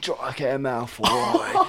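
very drawn-out British name; preening British accent, slow and showy; Draco Malfoy. Shown as a demonstration. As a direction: stretch the surname out in mock British. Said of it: "every time he talks".

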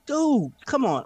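drawn-out, pleading Dude come on; like you can't believe it; exasperated. Retold or imitated. Exasperated retort to Pete Rose ban.

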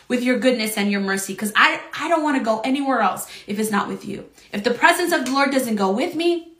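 ordinary talk, nothing marked

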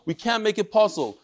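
ordinary delivery

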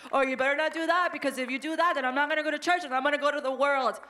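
mock tantrum threat, rushed and pushy; loud bratty threat. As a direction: shout it like a brat bargaining with parents. Child threatening parents, acted quote.

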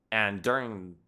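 usual speaking voice

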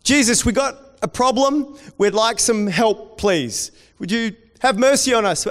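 high, loud pleading cry for help; desperate calling out. Acted. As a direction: call out like blind beggars straining to be heard. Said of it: "they start calling out".

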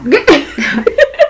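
high burst of laughter. Real, not acted. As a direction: laugh out at your own story. Laughs at own story. (carcajada aguda)

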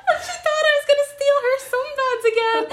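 very high, fast squeal through laughter; laughing hard. Real, not acted. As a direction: barely get it out, cracking up. Cracks up at the misunderstanding.

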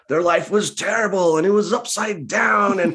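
high, dramatic trailer-style narration; hammed-up trailer voiceover; movie trailer voice. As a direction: pitch it up like an overblown film trailer. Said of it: "They just turn into, like, trailer voice".

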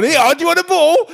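high coaxing voice to a dog; sing-song dog voice. Pretend. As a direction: call the dog high and bright.

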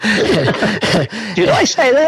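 slow, high, drawn-out question; can't believe he said that; amused disbelief. Real, not acted. Ian hearing his own old joke, drawn-out surprise.

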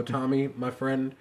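ordinary talk

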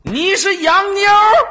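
high loud disbelief; shocked. Real, not acted. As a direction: squeal it in disbelief. Shocked at Russian claim. (震惊地尖叫)

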